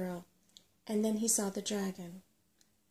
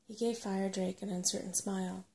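usual speaking voice

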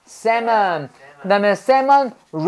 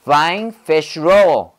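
"Flying fish roll" with a distinct beat on each word; accented on every word; 4 semitones lower, much slower than usual. Shown as a demonstration. Teacher modelling the accents for students, every word stressed. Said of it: "액센트를 넣어줘야죠".

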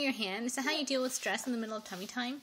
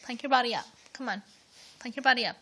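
usual speaking voice

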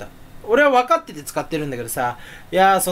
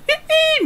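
high drawn-out えー, mouth opened wide; stretched mouth vowel; 3 dB louder, slower than usual. Shown as a demonstration. Demonstrating the mouth stretch to viewers, high long えー. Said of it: "みたいなね".

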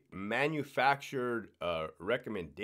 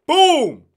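shouted boom of an impact round, much higher and louder; gunshot boom; 17 dB louder than usual. Retold or imitated. Describing a head shot, he shouts a mock "boom" much louder. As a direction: blast out a mock gunshot sound.

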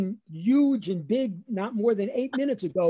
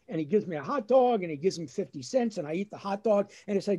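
ordinary talk

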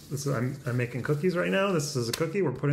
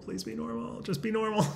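high, squeezed plea to oneself; high-pitched begging under the breath; strained self-pleading; 9 semitones higher, 5 dB quieter than usual. Real, not acted. Pleading with herself on camera.

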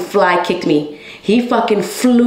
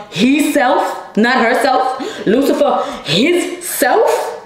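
laughing through the words, pitched higher; 5 semitones higher, much slower than usual. Real, not acted. Telling viewers about his wife, laughing. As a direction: scoff with a laugh at the devil. Laughing while naming the devil.

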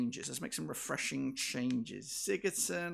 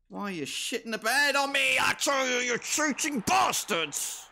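furious rant; 9 semitones higher, 6 dB louder than usual. Real, not acted. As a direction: rage at the cheating opponent. Raging at cheating game.

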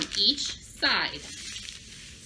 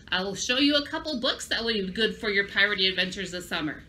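normal talking voice

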